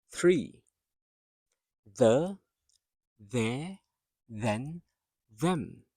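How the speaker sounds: "three, the, their, then, them" one by one, tongue-out th first; TH at word front. Shown as a demonstration. Teacher showing students initial TH, careful articulation. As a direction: articulate the opening th in each separate word. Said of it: "you also have T H at the front".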